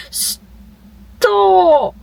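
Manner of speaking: loud, high drawn-out cry; shocked yell. Real, not acted. Reader crying out as a character dies, loud yell. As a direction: scream the word in disbelief.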